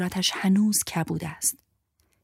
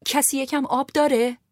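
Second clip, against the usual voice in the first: calling out; 8 semitones higher than usual. A narrator's reading. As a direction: call out to everyone for water. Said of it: "صدا زدم". (صدا زدن بلند)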